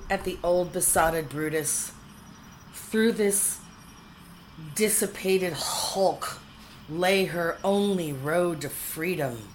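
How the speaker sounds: ordinary delivery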